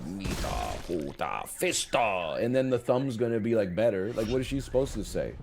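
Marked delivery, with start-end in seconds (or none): 0.1-2.4 much lower and softer, a pretend incantation; mock healing whisper. Wife whispering a healing spell over his thumb, hushed mock chant. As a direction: murmur nonsense like a spell. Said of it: "she's gonna whisper".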